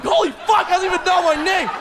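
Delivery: high, loud, fast giddy outburst; thrilled. Retold or imitated. Comic hears the DJ say his name, high giddy outburst. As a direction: burst with disbelieving joy.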